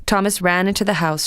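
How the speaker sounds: plain reading voice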